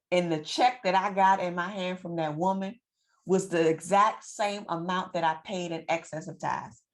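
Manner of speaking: ordinary talk, nothing marked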